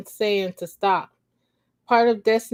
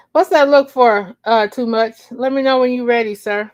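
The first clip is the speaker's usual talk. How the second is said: louder, higher, fast; teasing call-out; 3 semitones higher, 6 dB louder than usual. Real, not acted. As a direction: call out the listener playfully. Teasing someone off-mic.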